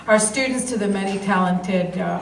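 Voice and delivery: ordinary talk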